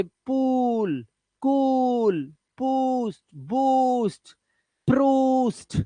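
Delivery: पूल, कूल, बूस्ट slowly held on 'oo'; long vowel sound. Shown as a demonstration. Teacher demonstrating the big 'oo', long vowel sound. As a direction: stretch the 'oo' in बूस्ट and प्रूस्ट. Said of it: "इसमें long vowel sound होता है".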